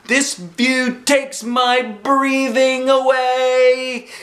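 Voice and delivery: slow, high, loud holler. A narrator's reading. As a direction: call out over the engine noise. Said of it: "I hollered to Mother and Daddy".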